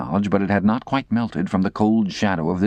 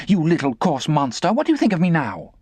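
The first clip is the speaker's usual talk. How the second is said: put-on angry girl; 9 semitones higher than usual. Estella after the slap.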